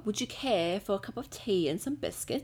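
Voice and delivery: amateur mock British; British accent. Shown as a demonstration. Fran's turn in the accent challenge, British accent. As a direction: put on British, dropping the R. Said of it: "First one, British".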